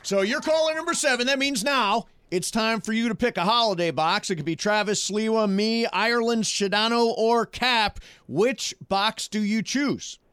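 high, excited game-show host; radio contest promo. Acted. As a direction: pump up the caller like a prize host. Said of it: "I love how excited you get when you say".

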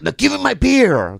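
high-pitched mock demand; angry customer. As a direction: imitate an irate customer demanding. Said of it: "he was already angry".

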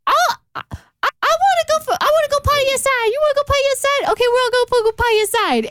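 high, fast cartoon dog voice. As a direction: talk like the family pet, way up high. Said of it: "we do this thing called the dog voice".

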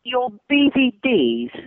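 a confused colleague asking, slowed and puzzled; puzzled question. Retold or imitated. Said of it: "He's like".

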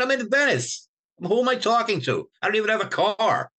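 pitched high, pleading his innocence; exasperated protest. Retold or imitated. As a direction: insist you couldn't have told anyone, voice up high. Defending himself against Frankie's accusation.

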